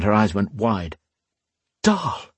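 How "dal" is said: high, astonished breath of a name; barely believe it as you say it; breathed in astonishment. A narrator's reading. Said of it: "she breathed".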